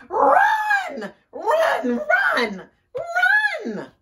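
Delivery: very high, loud repeated shrieks; panicked screaming. A narrator's reading. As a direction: scream for everyone to flee. Everybody flees in panic.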